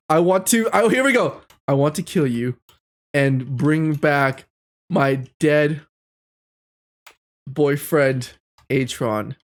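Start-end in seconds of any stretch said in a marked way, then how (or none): 1.7-9.4 a hint of a dark villain; low, slow menacing read; dramatic villain read. Reading villain line dramatically.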